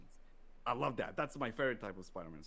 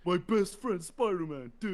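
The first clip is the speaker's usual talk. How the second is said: higher, louder mock Electro line; villain imitation; 7 semitones higher, 8 dB louder than usual. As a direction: throw in the villain's line, hammed up. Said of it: "joins the villain quote".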